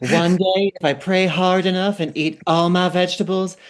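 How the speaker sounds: channel Lila with a Southern lilt; Southern accent. Retold or imitated. Podcaster reads Lila's catty line, in Southern accent. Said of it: "you doing a Southern accent".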